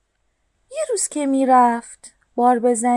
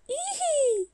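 high soft whinny; the horse whinnies hello at the truck; horse neigh; 14 semitones higher, 8 dB quieter than usual. A narrator's reading. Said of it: "اسبه گفت".

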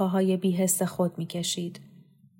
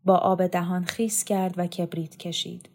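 plain reading voice

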